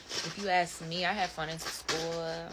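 normal talking voice

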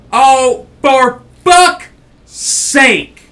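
real rage, drawn out and loud; slow furious yell; furious shout. Real, not acted. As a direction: blow up at the game. Raging at the game.

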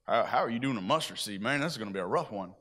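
lightly low Donald voice, laid-back doubt; Donald impression. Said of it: "that's my impersonation of Donald".

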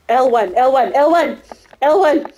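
loud, rising urgent callout; urgent shout. Real, not acted. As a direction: yell the target to the team. Urgent target callout mid-fight.

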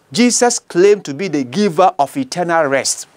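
normal talking voice

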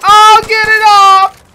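high, loud, drawn-out cry; real frantic shout; panicked shout. Real, not acted. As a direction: yell in alarm for it to go away. Panics at game enemy.

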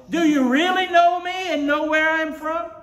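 high, full-force preaching shout; loud voice. Acted. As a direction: hurl the question across the temple. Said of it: "in a loud voice".

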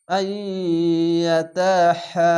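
আইয়াতাহা with the তা held one alif; drawn-out recitation. Shown as a demonstration. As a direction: stretch the তা of আইয়াতাহা slowly. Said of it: "জবরের পাশে খালি আলিফ, এক আলিফ টেনে পড়বেন". (আইয়াতাহা এক আলিফ টেনে)

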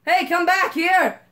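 high, loud chase call; excited mock shout; playful yell. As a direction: shout after it as it runs off. Chasing the creature.

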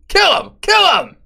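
high, loud yell; shout. Real, not acted. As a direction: bark the order. Shouting at game ghouls.

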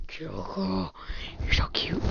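soft whisper to the pet. Real, not acted. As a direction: coo it in a whisper.

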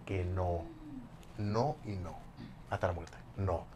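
negarlo bajito y grave, sin alterarse; low, soft, stubborn denial, retold; negación contenida. Niega todo ante su ex. (negación en voz baja y grave)

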